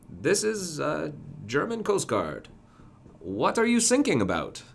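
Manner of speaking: put-on high, loud German-accented coast guard; a new English speaker in a German accent, puzzled. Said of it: "And he goes".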